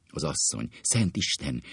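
usual speaking voice

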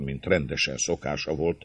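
ordinary delivery

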